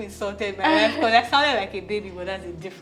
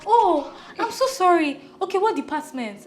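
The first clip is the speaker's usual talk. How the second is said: mock apologetic; 10 semitones higher, 7 dB louder than usual. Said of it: "the next thing she goes".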